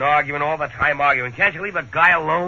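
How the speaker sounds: loud exasperated snapping; fed up, push her off; irritated outburst. Acted. Husband to nagging wife, exasperated loud complaint.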